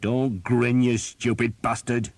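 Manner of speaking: gruff captain snapping at a grinning archer; snapped. A narrator's reading. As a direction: bite his head off. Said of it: "Skeet snapped".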